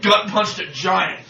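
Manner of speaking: normal talking voice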